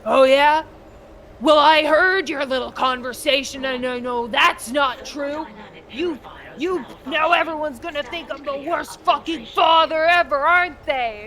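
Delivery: loud, furious accusing dad; angry dad shouting. Retold or imitated. A dad yelling at his son over a neighbour's visit, furious and loud. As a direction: tear into the kid with drunken rage. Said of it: "And he's just like".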